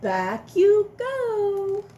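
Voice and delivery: high, slow, playful telling-off; broad singsong kids theatre. Acted. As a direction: sing it out, sending him back. Sending dinosaur back to tub.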